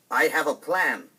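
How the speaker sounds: loud, pushy stepmother voice; scheming stepmother. Acted. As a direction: announce the plan loudly, full of herself. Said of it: "the stepmother said".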